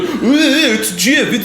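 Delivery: slow, laughing mock voice of the gif creator; slow laughing parody of the gif creator. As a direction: sneer at him, slowly, through a laugh. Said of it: "the creator comes out and he's like".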